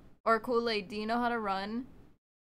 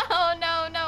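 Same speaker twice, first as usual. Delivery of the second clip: laughing; 6 semitones higher, 8 dB louder than usual. Real, not acted. Gets stuck in game.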